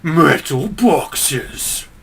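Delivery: very slow, drawn-out METAL BOXES in a put-on villain voice; Chaos Marine voice. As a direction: stretch every syllable like a menacing warlord. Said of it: "Chaos Marines saying".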